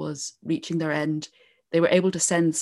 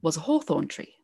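epic fantasy narrator; 2 semitones higher than usual. Acted. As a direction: build the legend like a fireside tale. Starts telling the O'Neill legend.

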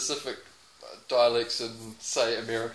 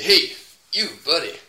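put-on Southern US accent; 3 semitones lower, 3 dB louder than usual. Man warning a passer-by, put-on Southern accent. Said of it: "Southern American".

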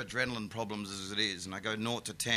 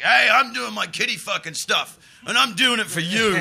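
loud, high self-satisfied boast; mock a martyr comic bragging; smug comedian; 9 semitones higher, 12 dB louder than usual. Mocking comedians who think they are martyrs, smug and loud. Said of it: "of, like".

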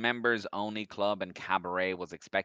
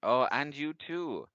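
flirty dancer voice; 8 semitones higher, 6 dB louder, slower than usual. Acted.

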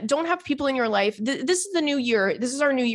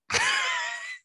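a very high shriek of laughter; 4 dB quieter than usual. Real, not acted. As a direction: crack up with a squeal. Cracking up at her own joke.

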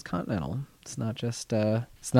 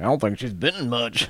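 put-on Southern accent; 2 semitones higher than usual. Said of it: "You need to work on your Southern accent".

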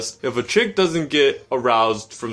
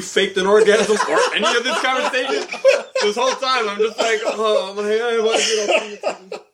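laughing; 8 semitones higher than usual. Real, not acted. Laughing while asking.

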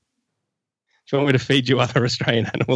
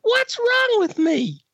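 very high falsetto. Acted. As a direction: squeak it out like a cartoon girl. Falsetto character bit.

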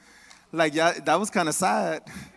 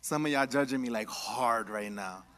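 teasing aside; 9 semitones lower, 12 dB quieter than usual. Real, not acted. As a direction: drop it low like a confession. Joking aside to congregation.

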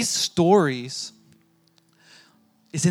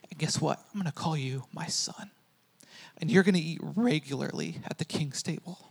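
tender, hushed King of Kings; 6 dB quieter than usual. Retold or imitated.

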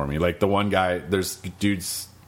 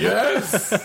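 high, loud victory yes; triumphant yes; 14 semitones higher, 4 dB louder than usual. Retold or imitated. Player celebrating as his horse returns, high triumphant yes. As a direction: celebrate the kill. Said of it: "I was like".

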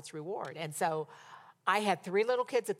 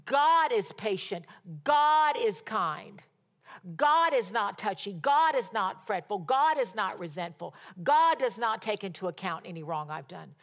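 emphatic declaration; 4 dB louder than usual. Shown as a demonstration. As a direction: proclaim each truth, leaning on GOD. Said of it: "say it toward you".

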